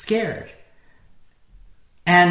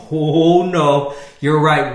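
louder, alarmed mock cry of panic; 7 dB louder, much slower than usual. Preacher voicing Jesus as he did not react: mock alarm. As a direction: play a panicked disciple, half mocking. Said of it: "He doesn't wake up and say".

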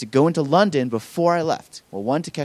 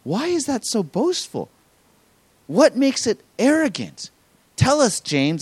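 curious congregation; 8 semitones higher than usual. Retold or imitated. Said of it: "it makes us ask this question".

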